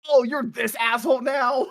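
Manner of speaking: loud, high outraged challenge; angry shout. Acted. Character accusing the other in a quarrel, angry shout. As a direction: shout it in disbelief.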